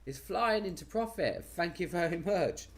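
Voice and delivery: high, thrilled exclamation; excited. Real, not acted. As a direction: burst out with delight. Trade suddenly in profit.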